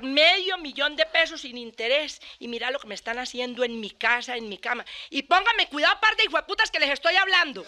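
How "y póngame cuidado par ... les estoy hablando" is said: high, loud furious shout at the cheaters; angry yell. Acted. Cuckolded husband yelling at the pair in his bed, furious. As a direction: bellow at them to pay attention.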